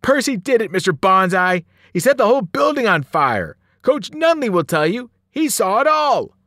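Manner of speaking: put-on a scared boy shouting blame at the teacher; terrified accusation. A narrator's reading. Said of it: "with a look of terror".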